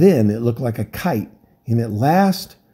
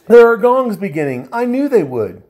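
terrified; 10 semitones higher, 5 dB louder than usual. Acted. Terrified sailor.

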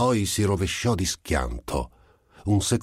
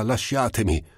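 screamed; 6 semitones higher than usual. Acted. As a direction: cry out to be let go, acted. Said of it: "urlò la Gilda".

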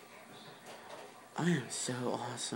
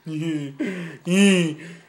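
laugh; 4 semitones higher, 11 dB louder than usual. Real, not acted. Laughing after the whisper.

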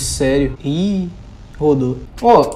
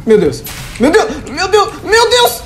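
each cry higher, building to a squeal; rising panic; 10 semitones higher than usual. Real, not acted. Scared gamer repeating it, higher each time. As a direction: let the panic climb with every repeat.

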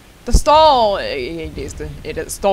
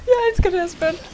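giddy gushing; 10 semitones higher than usual. Real, not acted. Gushing about the game.

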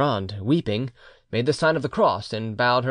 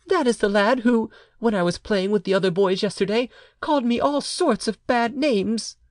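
put-on high little boy's voice; child; 9 semitones higher than usual. A narrator's reading. Child reports being called names, in a child's voice. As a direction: tell it high, as an upset child. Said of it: "continued the child".

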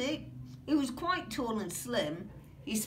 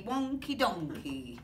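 usual speaking voice

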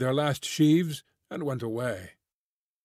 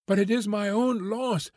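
pleading; 6 semitones higher than usual. A narrator's reading. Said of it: "she pleaded".

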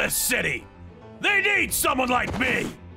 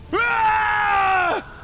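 higher, sustained scream; agonised scream; 6 semitones higher than usual. Acted. Captive on the hot chair, screaming in agony. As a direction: hold a long cry of pain.

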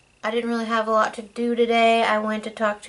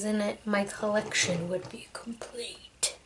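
breathy spooky whisper, hushed and eerie; creepy whisper; 8 dB quieter than usual. Acted. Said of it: "sorry about the creepy voice there".

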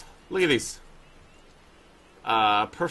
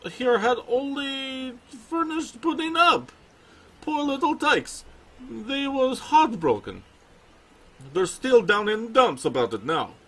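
put-on light Russian accent, pitched higher; 8 semitones higher than usual. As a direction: voice the bear trainer with a Russian accent. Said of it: "I'm gonna give him a Russian accent".

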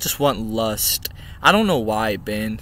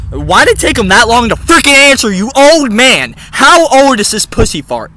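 higher, louder exasperated rant; mock yell; 7 semitones higher, 9 dB louder than usual. Yelling at slow dog.

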